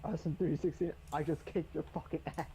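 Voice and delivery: ordinary delivery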